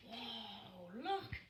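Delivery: usual speaking voice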